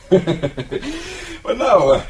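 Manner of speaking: a high chuckle on the words; captain chuckling before questioning Haywood; laughing. Acted. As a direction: still amused, laughing as he speaks.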